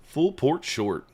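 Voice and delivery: Full port short, slow and weighing each word; slow it right down and let it sink in; slow deliberate repeat. Acted. Muttering Tom's advice to himself, slow and deliberate.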